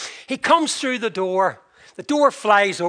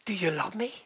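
whispered echo in disbelief; incredulous whisper; 8 dB quieter, faster than usual. Retold or imitated. Wife whispering his question back, stunned. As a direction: breathe it back, astonished.

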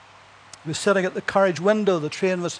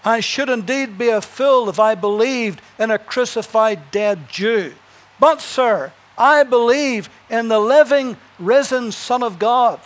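louder, higher defiant answer; bold reply; 3 semitones higher, 4 dB louder than usual. Acted. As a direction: stand up and answer boldly. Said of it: "Pastor jumps to his feet".

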